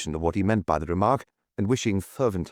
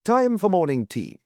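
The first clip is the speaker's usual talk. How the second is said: brisk; 10 semitones higher, 3 dB louder than usual. A narrator's reading. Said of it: "said Anthony briskly".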